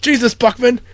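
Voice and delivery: high, loud disgusted tirade; hammed-up officer screaming at the cook; angry yelling. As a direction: rage at the filthy kitchen. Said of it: "yelling at Buckman".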